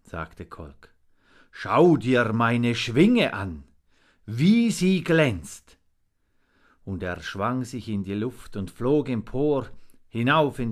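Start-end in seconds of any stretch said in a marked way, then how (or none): 1.5-5.8 loud, drawn-out boast; proud showing off. A narrator's reading. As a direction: show off proudly, slow and loud. Raven proudly shows off his wing.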